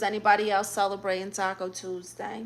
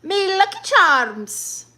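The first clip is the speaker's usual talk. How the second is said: high, loud, drawn-out mock Irish catchphrase; hammed-up bad Irish, stretched out; terrible Irish accent; 8 semitones higher, 6 dB louder, slower than usual. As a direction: put on a badly faked Irish accent. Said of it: "It's a terrible Irish accent".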